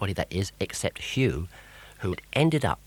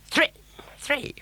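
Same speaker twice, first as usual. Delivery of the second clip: witch shouting. Acted.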